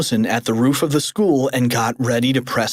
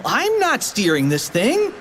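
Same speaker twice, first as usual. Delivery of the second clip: put-on high kid voice; 9 semitones higher than usual. Character replies.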